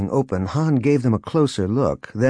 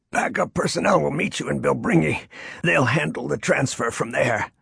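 higher, strained, pained voice; groaning in pain; 5 semitones higher than usual. A narrator's reading. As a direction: force the words out through pain. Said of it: "officer groaned in pain".

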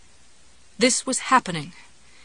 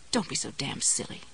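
scolding; 7 semitones lower, 8 dB quieter than usual. A narrator's reading. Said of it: "she scolded herself".

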